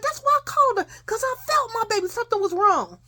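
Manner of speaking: high, urgent, emotional explanation; acted distraught mother. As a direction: insist it like a mother who sensed trouble. Distraught mother explains her call.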